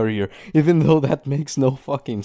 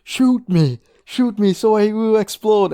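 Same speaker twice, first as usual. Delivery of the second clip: raised, louder mock plea; acted urgent command; 7 semitones higher, 4 dB louder, slower than usual. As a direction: imitate an anime hero demanding to be shot. Imitating the dubbed scene's command.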